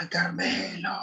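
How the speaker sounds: high excited cry from afar; crowd cheering. Retold or imitated. La gente celebra al Carmelo, grito eufórico. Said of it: "estalló en gritos".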